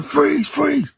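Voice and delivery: high-pitched police shout; acted yell. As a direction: yell it after a fleeing suspect. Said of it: "He yelling".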